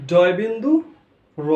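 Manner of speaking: loud "ডয় বিন্দু" rising, pause, "র"; corrected, separated name. Shown as a demonstration. Teacher correcting the letter's name, loud "ডয় বিন্দু", then separate "র". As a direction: break "ডয় বিন্দু র" before the last letter. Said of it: "এটা হবে ডয় বিন্দু র".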